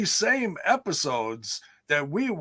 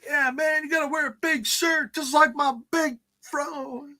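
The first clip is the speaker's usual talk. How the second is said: higher-pitched mock laid-back guy; imitating a wrestler; 10 semitones higher than usual. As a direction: put on a high, drawn-up quote voice. Acts out D-Ray talking.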